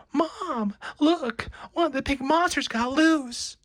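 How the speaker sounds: put-on high, loud child's cry; a little kid pointing and yelling to mom; excited kid. A child shouting to mom about the loose monster.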